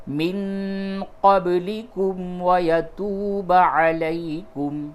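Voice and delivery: slow, high chanted tajweed recitation; Quran recitation. Shown as a demonstration. Teacher chanting the verse for students, slow and melodic. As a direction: intone the verse slowly and melodically.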